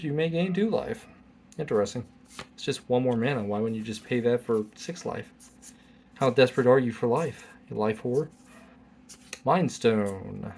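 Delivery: ordinary delivery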